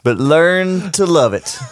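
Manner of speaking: high, slow wrestler swagger; Ric Flair. Acted. Podcaster impersonating a wrestler for friends, high and drawn out. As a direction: stretch each word out like a boasting wrestler. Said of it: "you sound just like him".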